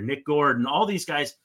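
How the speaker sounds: ordinary delivery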